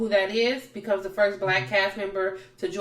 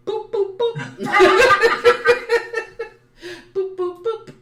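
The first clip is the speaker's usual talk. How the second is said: playful punch sounds; 13 semitones higher, 7 dB louder than usual. Retold or imitated. Mimicking the blows in a fight.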